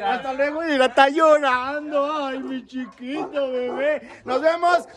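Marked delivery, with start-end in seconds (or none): 0.6-4.2 cooing sympathy. Real, not acted. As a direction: coo tenderly at someone in tears. Cooing over crying José.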